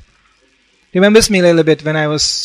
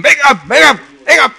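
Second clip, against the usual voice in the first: calling wake up; 10 semitones higher, 3 dB louder than usual. Retold or imitated. Shaking sleeper awake by sound.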